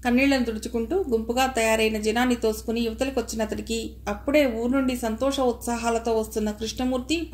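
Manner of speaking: plain reading voice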